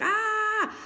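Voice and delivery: high, drawn-out "ah" of oversensitivity; mock startled "ah". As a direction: imitate a high cry at the slightest touch. Imitates touched moth's oversensitive reaction.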